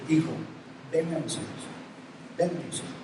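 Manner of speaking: muy bajito, tierno, como abrazando; llama al hijo con ternura en voz baja; tierno y suave. Retold or imitated. (padre acoge al hijo, susurro tierno)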